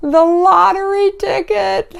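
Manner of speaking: high, slow, drawn-out savoring of the words; gleeful squeal. Acted. Vlogger relishing the show's delicious detail, high gleeful drawl. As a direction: squeal it slowly with mocking delight.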